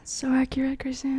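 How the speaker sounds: a soft whispered aside; whispered agreement through laughter. Real, not acted.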